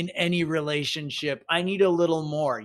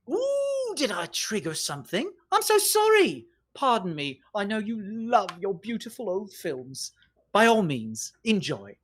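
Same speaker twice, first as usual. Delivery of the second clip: mock apology; 3 semitones higher than usual. Trilby needles Hatch about his old films, mock-apologetic. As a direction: taunt with exaggerated fake contrition.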